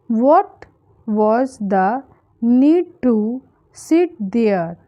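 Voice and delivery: broken up with clear pauses between phrases; word by word. Shown as a demonstration. Teacher modelling an English sentence for learners, word by word. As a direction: dictate it in small chunks for learners.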